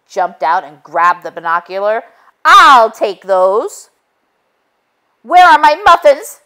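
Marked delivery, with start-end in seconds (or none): 2.4-3.9 slow and loud grab; menacing wolf. A narrator's reading. Wolf snatching the binoculars, slow and loud. 5.2-6.4 high, loud angry demand; screaming. A narrator's reading. Muffy screaming at the wolf for her muffins. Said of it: "screamed Muffy".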